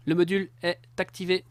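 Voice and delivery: robot voice. Pretend. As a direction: announce it like a machine. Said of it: "je fais une voix de, voix de robot".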